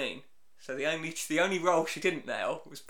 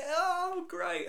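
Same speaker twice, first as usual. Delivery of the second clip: much higher mocking cheer; sarcastic gloat; 12 semitones higher than usual.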